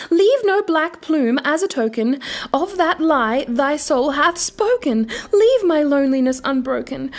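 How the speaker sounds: high, loud furious commands; shrieking fury. Acted. Enraged narrator ordering the raven away, high and loud. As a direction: drive the bird out with your voice. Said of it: "I shrieked".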